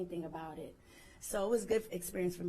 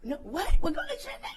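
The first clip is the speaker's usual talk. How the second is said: high, squealing can't-believe-it; much higher, louder, disbelieving yelp; stunned disbelief; 8 semitones higher, 11 dB louder, faster than usual. Retold or imitated. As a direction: shriek it like it can't be true. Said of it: "I didn't believe it".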